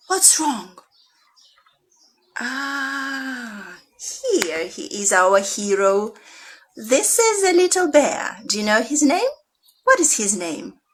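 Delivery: lively storytime reading, full of questions; reading to children. Acted. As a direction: pull the kids into the story with big swings.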